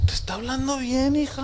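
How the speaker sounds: reassuring mother. Retold or imitated. Mother tells daughter her dad speaks fine, high soft voice. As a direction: imitate a mother soothing, lifted and hushed. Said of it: "y luego su mamá le dice".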